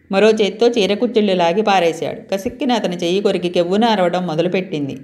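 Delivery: ordinary delivery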